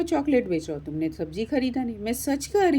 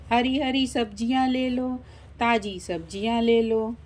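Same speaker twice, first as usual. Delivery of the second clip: chanting street hawker, long level phrases; vegetable seller's cry. Acted. Street seller crying her wares. (फेरी वाली की खिंची हुई हाँक)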